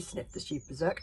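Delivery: ordinary delivery, nothing marked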